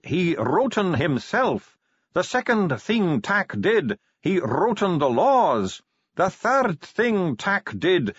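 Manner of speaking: high, slow, incantatory dwarf voice; chant-like telling, each clause stretched; Bashfulson reciting old scripture. A narrator's reading. Dwarf translates an ancient creation tale, slow and high.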